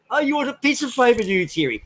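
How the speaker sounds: high-pitched mocking question; piss-taking singsong query. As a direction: tease him with a lifted, mock-innocent question. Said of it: "took the piss out of him".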